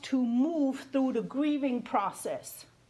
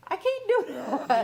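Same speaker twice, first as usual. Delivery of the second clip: higher, laughing through the words; 6 semitones higher than usual. Real, not acted. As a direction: laugh as you say it. Laughing while quoting herself.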